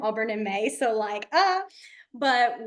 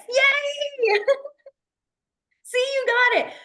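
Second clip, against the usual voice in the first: much higher, louder cheering shout; giddy cheer; 13 semitones higher, 5 dB louder, much slower than usual. Real, not acted. Teacher cheering a student who finally got it, high and loud. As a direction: celebrate her success out loud. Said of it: "I get giddy about every little thing".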